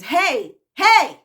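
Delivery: loud, high demanding yell; imitated scream. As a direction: holler for attention like a cranky patient. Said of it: "she screams".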